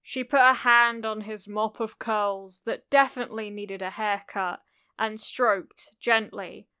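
ordinary delivery

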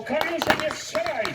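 restrained, high desperate appeal; cried plea. A narrator's reading. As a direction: cry out to a comrade in a high voice. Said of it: "Ten krzyczał".

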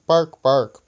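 half-hearted dog impression; read-out dog bark. A narrator's reading. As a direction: imitate two dogs barking, in words.

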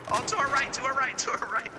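urgent callout. Real, not acted. Calling out enemy position.

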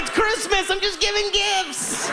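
high, slow mock-innocent excuse. To his startled wife, a high slow mock-innocent excuse. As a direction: play it sweetly innocent, drawn out.

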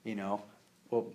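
ordinary talk, nothing marked

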